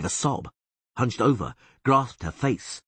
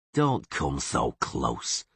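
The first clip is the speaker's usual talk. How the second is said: lower, slow, pretending revulsion; mock disgust; 4 semitones lower, much slower than usual. A narrator's reading. Witcher pretending disgust at the elf, slow and lower. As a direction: feign being repulsed. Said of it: "he grimaced".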